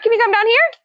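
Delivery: high, sing-song coaxing call; coaxing the bird. Real, not acted. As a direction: lure the parrot down with a high pet voice. Coaxing parrot down.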